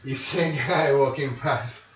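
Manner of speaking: ordinary talk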